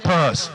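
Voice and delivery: Pus. said with a loud gross relish; savoring the word. Shown as a demonstration. Teacher to class, relishing the gross word. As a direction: spit out Pus. with disgust. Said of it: "My favorite, though, is pus".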